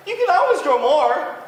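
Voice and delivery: high, loud bird voice. Acted. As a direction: careless bird teasing moose. Bird continues.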